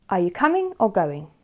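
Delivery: "coming" rises, "going" falls; up then down. Shown as a demonstration. Teacher recaps a choice, up on "coming", down on "going". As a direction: climb on "coming", drop on "going". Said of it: "then we go up and down".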